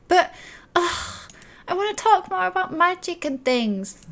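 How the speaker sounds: high-pitched whiny protest; grumbling child. Acted. Child dragged off upstairs to bed, grumbling high. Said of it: "you can hear her grumbling".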